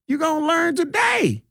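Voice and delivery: high-pitched catchphrase, last word falling away; high mock-scolding taunt; mock warning. Host warning his detractors, high-pitched mock warning.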